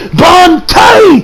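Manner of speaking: loud, high battle cry of Bankai!; shouted attack name. Retold or imitated. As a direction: yell it like unleashing a power.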